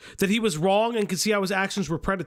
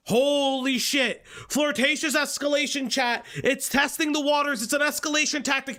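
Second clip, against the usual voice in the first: high, incredulous mocking read-out; mocking outrage; 4 semitones higher than usual. Real, not acted. Streamer reading critics' claims to chat, mocking outrage. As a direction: parrot the accusations as absurd, climbing at the end.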